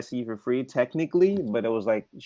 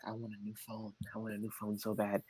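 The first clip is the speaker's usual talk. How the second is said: very soft, lower mutter to himself; quiet longing; 4 semitones lower, 17 dB quieter than usual. Retold or imitated. Customer eyeing display phones wishes privately, hushed longing. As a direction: wish for it under your breath.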